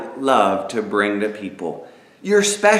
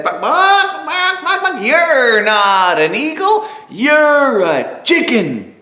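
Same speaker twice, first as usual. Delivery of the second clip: much higher, clucking chicken chorus; 10 semitones higher, slower than usual. Acted. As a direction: squawk it like mocking hens. Chickens mock the eagle together.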